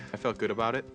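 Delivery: normal talking voice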